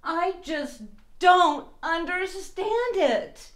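high, slow, baffled voice; drawn-out puzzled plea. Acted. Baffled penguin speaks.

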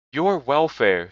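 a gentle ghost, slow and grave. A narrator's reading. Spirit answers Scrooge, lightly voiced ghost.

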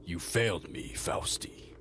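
cold, low menace fading to a breath; a dark master passing judgment; villain scolding his failed servant in a low voice. Acted. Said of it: "the lower voice go".

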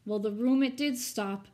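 ordinary delivery

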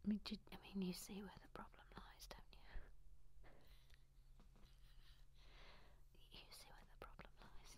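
barely voiced whisper; whispered confession. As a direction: whisper it as a hushed aside. Embarrassed confession about her habit.

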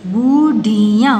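बुढ़िया slow, each syllable held. Shown as a demonstration. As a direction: sound out बुढ़िया in pieces. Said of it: "properly बोलना है". (बुढ़िया को तोड़-तोड़ कर, धीरे)

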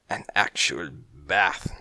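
low, dismayed repeat of actual bath, with bath rising. A narrator's reading. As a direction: say "An actual bath" low, head-shaking, the bath lifting at the end. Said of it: "shaking his head".

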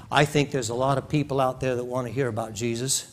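usual speaking voice

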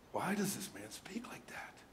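hushed unvoiced whisper of doubters. Scribes doubting silently in their hearts, whispered. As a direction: whisper it like a secret suspicion.

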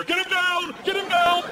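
high-pitched barked order; acted-out frantic shout; urgent yelling. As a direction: imitate a crewman hollering for a takedown.

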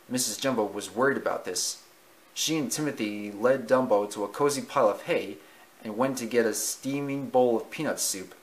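usual speaking voice

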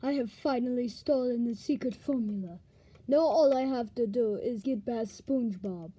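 low scheming villain voice; sneaky villain muttering. Acted.

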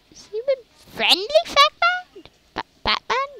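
high squeaky silly voice. Pretend. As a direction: squeak it like a cartoon. Squeaky mock voice.

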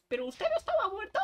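very high mock disbelief; catchphrase tone. Shown as a demonstration. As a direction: squeak the line for the clip. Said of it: "La frase".

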